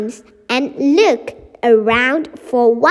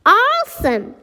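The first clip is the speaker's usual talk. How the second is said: higher-pitched happy exclamation; excited exclamation; 7 semitones higher than usual. Acted. Excited line of Sarah in story.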